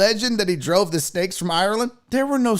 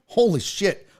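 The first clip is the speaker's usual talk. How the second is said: ordinary talk, nothing marked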